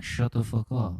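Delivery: irritated mock-French retort; hammed-up Frenchman; fake French accent. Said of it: "That was a fake accent".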